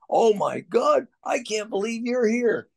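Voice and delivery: high, drawn-out starstruck disbelief; Travis Pastrana. Retold or imitated. Rider greets his hero on arrival, imitated high and starstruck.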